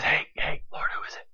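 hushed whisper of the question; John whispering to Jesus. Retold or imitated. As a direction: whisper it close to his ear. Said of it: "John whispers to Jesus".

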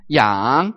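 Yang dipping then rising; third tone yang. Shown as a demonstration. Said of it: "we have the third one".

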